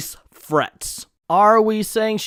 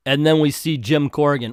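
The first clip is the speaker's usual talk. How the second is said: usual speaking voice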